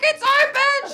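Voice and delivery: very high, loud screech; hammed-up squealed objection; frantic protest. Late passenger arguing with gate attendant, frantic high screech.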